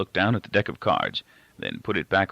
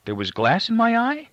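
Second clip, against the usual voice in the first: very high, alarmed disbelief; shocked question; 4 dB louder than usual. A narrator's reading. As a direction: blurt it out in alarm. Boy shocked by news.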